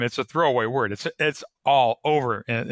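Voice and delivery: normal talking voice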